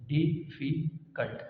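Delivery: डिफिकल्ट drawn out slowly, syllable by syllable. Shown as a demonstration. Teacher demonstrating the three syllables to the class, slow and drawn out. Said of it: "ये भी three syllable है".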